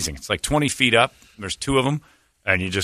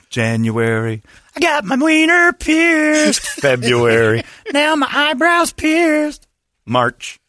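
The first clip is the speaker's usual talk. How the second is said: high whiny voice; much slower than usual. Acted. Acting out calendar months.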